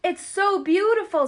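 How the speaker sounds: high, awed and bright; awed delight. Acted. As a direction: marvel at the sight. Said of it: "said Dorothy".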